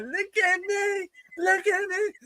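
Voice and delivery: very high, drawn-out falsetto; mock prancing diva. Prancing around in her jacket, mock girly falsetto. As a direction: show off in a silly high voice.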